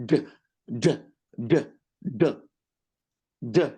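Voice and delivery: ordinary talk, nothing marked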